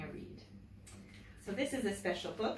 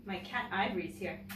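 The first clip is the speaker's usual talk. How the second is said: usual speaking voice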